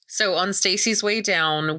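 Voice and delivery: ordinary talk, nothing marked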